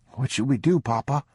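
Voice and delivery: put-on a small boy looking up at Papa, worried; frightened son asks father what to do; young boy voice.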